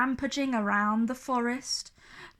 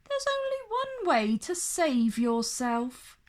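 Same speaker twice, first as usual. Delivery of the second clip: higher, sly dragon voice; 6 semitones higher than usual. A narrator's reading. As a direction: dangle the offer. Dragon offers deal.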